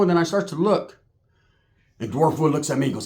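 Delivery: ordinary delivery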